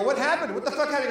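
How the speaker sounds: high, loud urgent questioning; alarmed. Retold or imitated. Asking the crying friend what happened, alarmed. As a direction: imitate panic at a friend's outburst.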